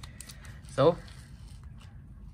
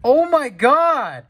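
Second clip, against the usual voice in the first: excited shout; 11 semitones higher, 8 dB louder, much slower than usual. Real, not acted. Hits a big card.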